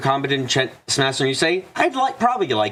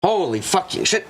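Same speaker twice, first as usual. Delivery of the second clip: sudden pain, high yelping; pained yelps; 7 semitones higher, much slower than usual. Real, not acted. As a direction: cry out from a stretching cramp. Pulled muscle while stretching.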